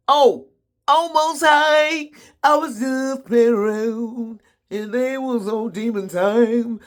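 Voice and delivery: high, slow whiny plea; praying to the Most High, high and slow; mock pleading prayer. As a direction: beg the Most High like a caught child.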